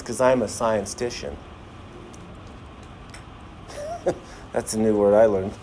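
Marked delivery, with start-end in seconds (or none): 3.7-4.2 a quick high giggle at his own joke; high laugh. Real, not acted. Teacher laughing at his own joke to the class, high giggle. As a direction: laugh at the made-up word. After joking "I'm a scientician.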